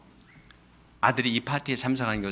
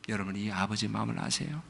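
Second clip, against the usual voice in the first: hushed question; 2 semitones lower, 12 dB quieter than usual. Acted. Hushed question after preaching.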